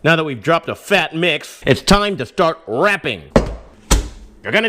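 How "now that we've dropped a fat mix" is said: ordinary talk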